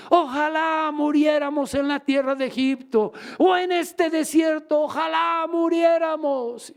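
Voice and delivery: high, drawn-out wailing shout; hammed-up lament of a whole multitude; crowd's crying out. Israelites complain against Moses in the desert, crying out. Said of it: "gritó y dio voces".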